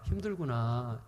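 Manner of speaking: low, tender acknowledgement; soft sympathy. Shown as a demonstration. Parent comforting a struggling child, softly sympathetic.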